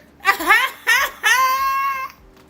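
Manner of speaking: high, loud burst of laughter; loud cackle. Real, not acted. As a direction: cackle loudly at the absurd claim. Laughs at the doctor claim.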